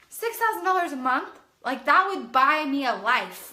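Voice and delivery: high, loud disbelief; can't believe the number; amazed. Retold or imitated. Stunned at the income.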